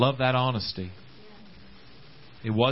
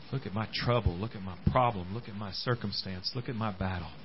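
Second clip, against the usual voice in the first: much softer, lower, defeated listing; weary complaint; 6 semitones lower, 8 dB quieter than usual. Retold or imitated. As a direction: sink into self-pity. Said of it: "look at all the things ... and say".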